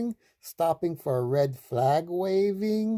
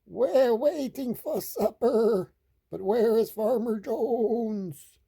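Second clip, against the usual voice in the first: high, bleating sheep; high wobbly animal voice; 8 semitones higher than usual. A narrator's reading. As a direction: quaver the words like a hungry sheep. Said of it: "say the sheep".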